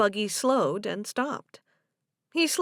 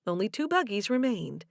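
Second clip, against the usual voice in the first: ordinary delivery, nothing marked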